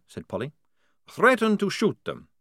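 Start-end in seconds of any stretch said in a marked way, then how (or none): 1.1-2.3 firm threat, pitched high then falling; firm, matter-of-fact order. A narrator's reading. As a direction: state it firmly, voice dropping at the end. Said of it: "said Maledict firmly".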